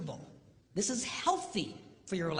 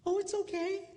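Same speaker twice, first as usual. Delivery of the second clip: mimicked wife; 10 semitones higher than usual. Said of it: "how's your love life".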